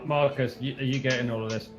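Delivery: ordinary talk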